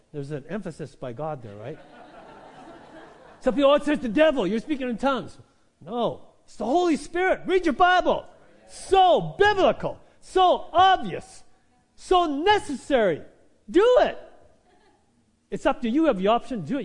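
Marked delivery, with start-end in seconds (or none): 3.4-8.2 loud, high, fervent preaching; full-force preaching. Acted. 8.7-11.4 very slow, loud and high, each word stretched; preacher slowing down to drive home the point; drawn-out emphasis. Acted. 11.9-16.8 loud, high, fervent preaching; full-force preaching. Acted.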